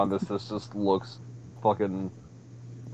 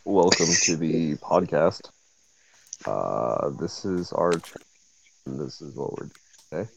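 mock ASMR voice; 5 semitones lower, 4 dB quieter than usual. Friend parodying an ASMR podcast welcome, low mock ASMR voice. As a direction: parody a hushed ASMR host. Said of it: "Welcome to A S M R".

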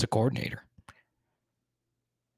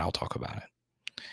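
whispered; 5 semitones lower, 11 dB quieter than usual. Real, not acted. Aside about the super chat policy.

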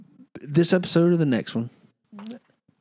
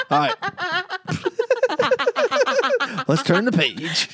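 laughing. Real, not acted. Still cracking up at accent.

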